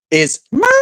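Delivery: squeaky cartoon-mouse mimicry; [name]'s Mickey Mouse voice. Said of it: "with her Mickey Mouse voice".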